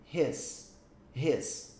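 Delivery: "His" pronounced "hes", E not I; his with an E sound. Shown as a demonstration. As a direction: say "His" as "hes", vowel like Portuguese E. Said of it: "Eu faço um som de E". ("His" com som de E, como "hes")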